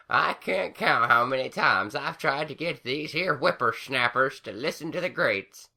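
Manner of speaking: put-on high old crone voice complaining; high old granny grumbling. A narrator's reading. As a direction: tease the youngsters. Granny Smith figure voice.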